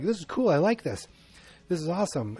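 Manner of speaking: high-pitched, playful wonder; delighted Kong. Acted. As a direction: lightly voice a thrilled giant ape. Voices delighted King Kong exploring.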